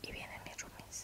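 aparte muy bajito, casi sin voz; susurro. Real, not acted. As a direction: susurra para que no te oigan. Roomies cerca, no quiere despertarlos. (susurro bajito)

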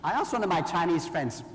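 ordinary talk

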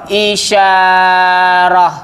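isyarah stretched very slowly, each syllable held; drawn out isyarah. Shown as a demonstration. Teacher spelling out isyarah slowly for students. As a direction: draw out isyarah for the class.

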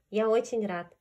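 plain reading voice

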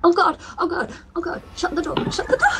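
frantic high panicked shouting. Real, not acted. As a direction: yell it in sudden terror. Panics as something comes at her.

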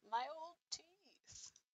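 put-on slow, quavering old man voice; mock old man whining about his teeth; old man impression. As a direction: put on a creaky old grandpa. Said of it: "That was my old man impression".

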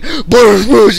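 loud, high boosh noises; two explosive mock static bursts; imitated buzzing boosh. Friend imitating a possessed microphone, loud boosh noises. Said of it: "If that thing starts going like".

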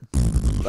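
low sputtering lip-buzz engine; motorcycle noise. Retold or imitated. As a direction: imitate a farting motorcycle engine. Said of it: "Your motorcycle sounds like farts".

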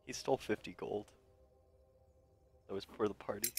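very soft, hushed admission; whispered confession. Acted. Character confessing about the party's stolen gold, whispering. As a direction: confess it barely aloud. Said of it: "I whisper to him".

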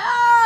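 mock whimper. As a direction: answer with a teary wail. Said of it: "She like".

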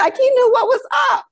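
high, loud, quick exclamation; real squeal of pride; proud excitement. Real, not acted. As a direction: burst out cheering for your son. Proud climax of story about son.

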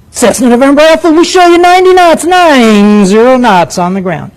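high, lilting air traffic controller read-back; sing-song controller voice. Retold or imitated. The Los Angeles Center controller answering a Cessna pilot, in a high sing-song. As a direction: make the little plane feel like a hero. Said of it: "they do that sing-song".

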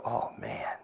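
whispered sleepy morning groan; groggy groan. Retold or imitated. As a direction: grumble like you just woke up. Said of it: "you go".

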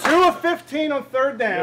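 excited. Real, not acted. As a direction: sell the number to the room. Coach hyping defense stats.

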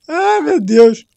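ordinary talk, nothing marked

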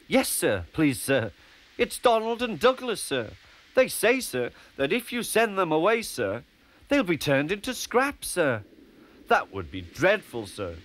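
high, anxious pleading; earnest, flustered little engine; nervous. A narrator's reading. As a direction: beg the boss on friends' behalf. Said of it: "You look nervous".

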